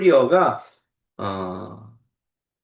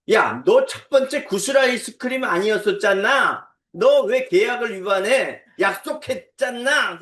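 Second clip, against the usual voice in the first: higher-pitched mock outrage; imitated angry child; 6 semitones higher, 3 dB louder than usual. Child accusing a sibling over changed ice cream choice, mock-angry. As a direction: act out a child's indignant accusation. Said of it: "그러면서 막 화를 내기 시작하는 거예요".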